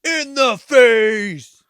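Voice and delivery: high, slow, drawn-out shout of the line; gleeful shout. Acted. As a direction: belt it out like the film's big moment. Quoting the gory movie moment.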